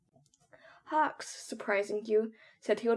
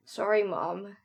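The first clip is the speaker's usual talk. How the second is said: ordinary delivery, nothing marked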